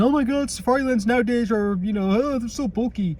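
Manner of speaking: high whiny mock complainer; whiny complaint. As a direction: whine about bulky holsters. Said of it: "heard people complain".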